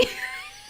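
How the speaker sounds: a silent high wheeze of laughter; squeaky breathless laugh. Real, not acted. Laughing at the punchline.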